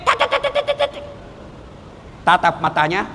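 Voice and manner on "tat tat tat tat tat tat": high squeaky imitation of a nagging wife's chatter; mock nagging wife chatter. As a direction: rattle it off high like a scolding wife. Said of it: "Istri cerewet".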